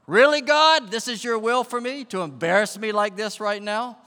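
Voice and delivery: high, very loud protest shouted at heaven; outraged cry to God. Acted. Golfer-like complaint to God over personal embarrassment, high outraged shout. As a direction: yell it in exasperated disbelief. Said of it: "That's how I felt inside".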